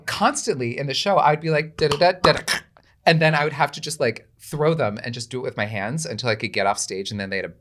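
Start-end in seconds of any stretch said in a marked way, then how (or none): 1.8-2.6 clip-clop rhythm sung out, a little higher and louder; horse hoof sounds. Retold or imitated. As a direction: mimic coconut hooves with the voice. Imitating coconut horse hooves.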